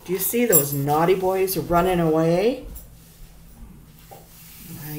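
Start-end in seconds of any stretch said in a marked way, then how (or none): none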